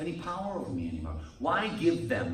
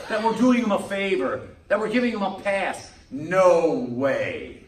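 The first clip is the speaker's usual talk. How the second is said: louder, hammering the point home; loud emphatic pulpit rebuttal; preaching loud; 4 semitones higher, 8 dB louder than usual. Real, not acted. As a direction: push it out to the back pews.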